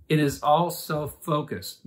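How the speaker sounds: ordinary talk, nothing marked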